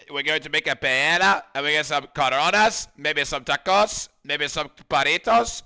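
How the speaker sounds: loud, hammed-up Spanish accent; terrible Spanish accent. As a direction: put on a bad, excitable Spanish accent. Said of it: "Maybe stop doing the terrible accent".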